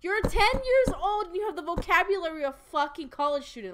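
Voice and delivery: high, loud, stunned yell; outraged disbelief. Real, not acted. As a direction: shout it in total disbelief. Outraged disbelief at game character.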